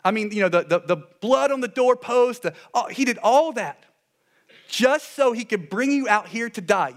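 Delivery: high, loud, emphatic disbelief; mock incredulous. Preacher voices the people accusing God, high and incredulous. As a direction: pile it on in worked-up sarcasm.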